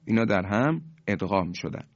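normal talking voice

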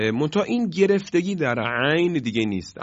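usual speaking voice

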